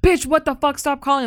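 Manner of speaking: mock-aggressive snap at a nuisance caller; aggressive outburst. Acts out aggressive girl yelling at ex.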